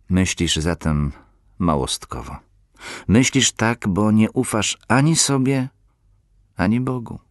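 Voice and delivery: impassioned sermon voice; preaching. Acted. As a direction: preach it with growing force. Preaching rebuke to listener.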